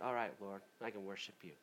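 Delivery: a quiet, relieved yes to God; quiet relief. Retold or imitated. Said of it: "we can come in like".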